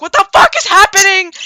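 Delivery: loud, high-pitched alarmed yell; panicked shock. Retold or imitated. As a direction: shout it like a bewildered bystander. Bystander witnesses a capture in panic.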